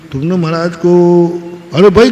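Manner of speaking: usual speaking voice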